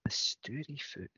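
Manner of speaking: a hushed, unvoiced Stouryfoot; whispered. Repeating the new word.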